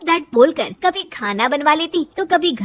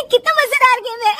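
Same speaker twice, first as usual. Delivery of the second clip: high giggly delight; high-pitched laughter at the TV; laughing daughter-in-law; 10 semitones higher, 4 dB louder than usual. A narrator's reading. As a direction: giggle all through the words. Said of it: "बहू के हंसने की आवाज".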